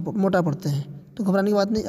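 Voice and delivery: ordinary talk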